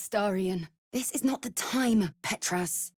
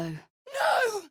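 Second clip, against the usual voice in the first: high, anguished no; desperate shout; 12 semitones higher than usual. Acted. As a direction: cry it out high and raw. Refusing brother's betrayal.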